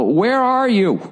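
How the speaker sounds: high, mock impatient demand; impatient arena guy. Arena guy on the phone to lost comedian, mock impatience. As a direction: imitate the caller, pitch jumping way up.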